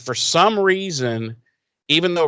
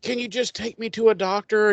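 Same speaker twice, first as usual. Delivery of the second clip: mock plea; 9 semitones higher than usual. Voices wounded Slayer pleading with Riley.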